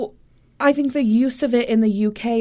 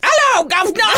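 high, loud hammed-up British greeting; mock British accent; 12 semitones higher, 4 dB louder, faster than usual. Joking along with co-host, in a high mock British accent. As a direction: chirp it like a cartoon Brit.

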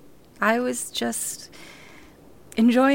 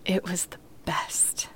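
soft sleepy whisper; whispered contentment tucked in bed; 3 semitones lower, 5 dB quieter than usual. Acted. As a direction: breathe it out at bedtime. Bedtime talk with grandma.

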